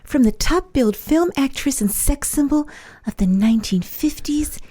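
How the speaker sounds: breathy, slow Marilyn Monroe purr. Acted. Impersonator introduces herself as Marilyn, slow and breathy. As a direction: seduce the room like Marilyn.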